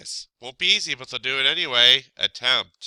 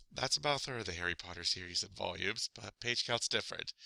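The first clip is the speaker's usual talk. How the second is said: quiet aside; 7 semitones lower, 13 dB quieter, faster than usual. Real, not acted. Aside correcting herself.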